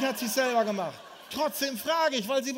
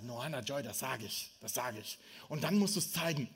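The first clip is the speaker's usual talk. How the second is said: hushed reply; 9 semitones lower, 7 dB quieter than usual. Retold or imitated. Father answers daughter's whisper.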